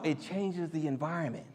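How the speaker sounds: ordinary delivery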